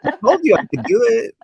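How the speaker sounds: ordinary talk, nothing marked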